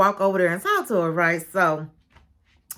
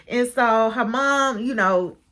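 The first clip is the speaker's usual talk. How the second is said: normal talking voice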